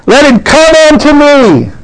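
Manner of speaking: high, drawn-out preacher's cry; crying out as Jesus. Retold or imitated. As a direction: call it out slowly, pitched far above normal speech. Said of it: "Jesus stood up and he cried".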